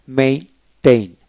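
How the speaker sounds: Maintain slowly split into main and tain; syllable-by-syllable. Shown as a demonstration. Teacher showing how to read the verb, maintain slowly split. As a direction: sound out maintain in two slow pieces. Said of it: "আসুন কিভাবে পড়বেন".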